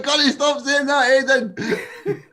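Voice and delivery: high, loud, laughing mock exasperation; retold exasperation. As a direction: plead in mock annoyance, pitch way up. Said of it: "I'm like".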